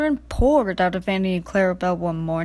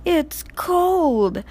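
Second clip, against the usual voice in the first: shivering; 6 semitones higher than usual. A narrator's reading. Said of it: "shivered Percy".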